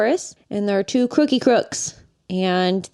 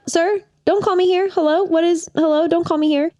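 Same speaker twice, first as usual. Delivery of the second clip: Cruella on the phone; 7 semitones higher than usual. Retold or imitated. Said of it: "she's like".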